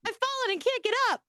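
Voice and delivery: high-pitched catchphrase wail; mock helpless cry. Joking about her old hip, high mock cry for help. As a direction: call out high, as a hammed-up fallen oldster.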